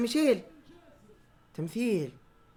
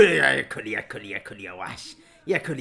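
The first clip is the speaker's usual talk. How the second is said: ordinary talk, nothing marked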